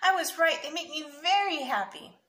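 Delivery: put-on high, loud little-boy delight; happy child. A narrator's reading. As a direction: gush it like a pleased kid. Happy child thanking mother in story.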